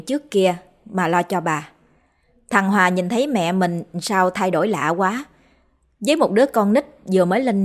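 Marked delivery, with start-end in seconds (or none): none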